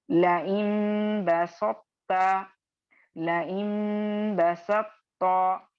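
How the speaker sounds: "लइन बसत्ता" drawn out slowly, न turning into म before ब; slow tajweed reading of "लइन बसत्ता"; recitation demo. Shown as a demonstration. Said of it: "यहाँ पे एक और आप गौर करें". (धीमी तिलावत, इखलाब के साथ)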